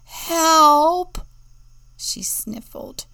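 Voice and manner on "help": very loud, high cry for help. A narrator's reading. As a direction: yell for help, lost and scared. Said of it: "sat down on a rock and cried".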